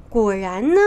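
ordinary delivery, nothing marked

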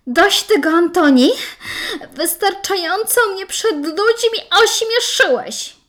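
sharp, high, loud outburst; angry. Acted. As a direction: snap at him, fed up. Wife fed up at her wedding.

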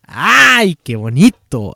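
ay qué bonito shouted in delight; delighted cry. Real, not acted. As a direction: gush out loud at the beauty of it. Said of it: "grité muy cabrón".

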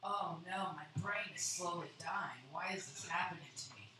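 low, soft mock dying lament; mock despair. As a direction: fade out like your brain is dying.

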